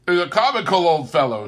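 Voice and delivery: high, loud, fast amused remark; amused nephew. A narrator's reading. Nephew telling his guests about his uncle, amused. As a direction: chuckle it out fondly. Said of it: "said Scrooge's nephew".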